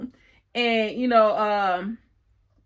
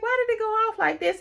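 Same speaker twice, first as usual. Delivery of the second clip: much higher, incredulous rant; exasperated complaint; 13 semitones higher than usual. Real, not acted. Viewer complaining the episode ended there, exasperated and much higher. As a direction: protest at the show like you can't believe it. Ranting about the episode ending.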